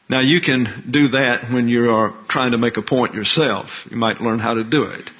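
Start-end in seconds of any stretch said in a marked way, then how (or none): none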